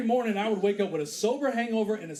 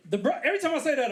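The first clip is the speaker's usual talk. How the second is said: ordinary talk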